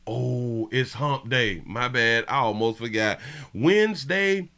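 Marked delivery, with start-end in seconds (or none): 3.5-4.5 high, loud excited call; excited high yell. Real, not acted. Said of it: "sudden excited shout of segment".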